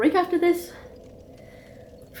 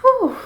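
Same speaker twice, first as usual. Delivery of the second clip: high relieved whew; 12 semitones higher, 8 dB louder than usual. Real, not acted. Relief after boss victory.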